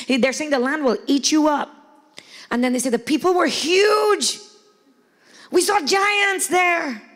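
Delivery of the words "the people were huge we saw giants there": higher, slow, drawn-out alarm; fearful spies. Retold or imitated. Spies scaring Israelites with giants, dramatized alarm. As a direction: make the giants sound enormous.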